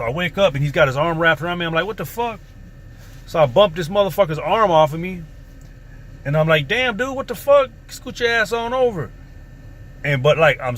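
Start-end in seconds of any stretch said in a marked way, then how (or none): 1.9-2.4 high, startled what-the-fuck; jolted awake and caught off guard; shocked outburst. Retold or imitated. Twelve-year-old waking to a man's arm, high startled exclamation. 6.7-9.1 higher, exasperated shove-off; irritated outburst. Retold or imitated. Boy telling the man to scoot over, high irritated complaint. Pushing the man's arm off.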